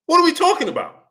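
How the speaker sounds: very high, exasperated question; can't believe what he's hearing. Real, not acted. Ranting to the chat about commenters, high and exasperated.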